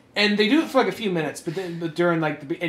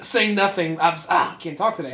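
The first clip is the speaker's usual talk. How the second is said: usual speaking voice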